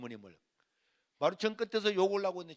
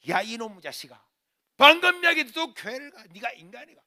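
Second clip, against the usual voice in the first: loud outburst, peaking high; exasperated yelled scolding; cursing mother; 6 semitones higher, 15 dB louder than usual. Retold or imitated. Mother hearing son is off to church, angry shouting. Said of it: "욕을 하시는데".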